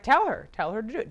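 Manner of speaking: loud, high first command, then easing; acted fatherly insistence; insistent. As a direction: press the order on a reluctant son. Acted dad insisting to son.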